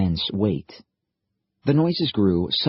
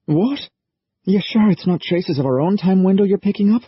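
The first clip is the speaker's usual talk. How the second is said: higher, louder, shocked question; startled disbelief; 12 semitones higher, 5 dB louder than usual. Acted. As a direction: blurt it out, can't believe it. Liam startled by news.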